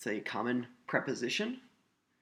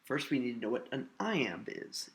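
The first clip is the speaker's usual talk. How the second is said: ordinary talk, nothing marked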